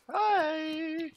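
sudden squeaky loud hi; loud high greeting. Acted. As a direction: pop out with a high shout. Jump scare greeting.

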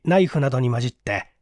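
plain reading voice

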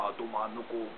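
almost soundless, just mouthed; mouthed silently. Shown as a demonstration. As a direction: mouth the words, barely voiced. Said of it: "but don't say it".

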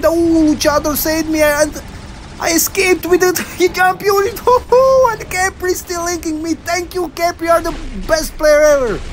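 high-pitched, elated gushing; thrilled relief. Real, not acted. Player thanking the teammates who saved him, high giddy excitement. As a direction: exclaim high, with bursts of laughter.

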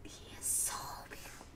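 soft, quick whisper; hushed, breath-only admiration; awed whisper. Real, not acted. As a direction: whisper it, overcome with awe. Overwhelmed at returned character's appearance.

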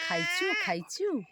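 high, slow bird-like "খাইচু, খাইচু"; imitating the myna. Owner mimics how the bird asks about eating, high and slow. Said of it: "ও ওই মা, মা বলে, বলে".

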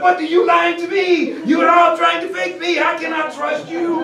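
put-on high, loud, accusing French-accented outburst; mock indignant French general. French general accusing the submarine crew, mock angry French accent. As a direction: act betrayed and outraged, hammed-up.

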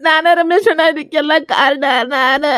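crying self-blame; tearful and broken; despairing sobs. Acted. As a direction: wail it out, giving up on life. Grieving man blames himself.

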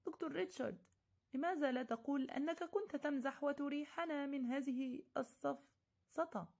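high, begging plea, slowing at the end; pleading. Acted. Narrator begging Dr Richard to end the argument. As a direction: beg him to admit it. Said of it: "متوسلا".

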